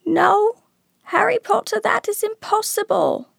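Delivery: very high-pitched elf voice; Dobby. A narrator's reading. House-elf refusing his master's friend, high squeaky elf. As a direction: pipe it high like a small servant elf.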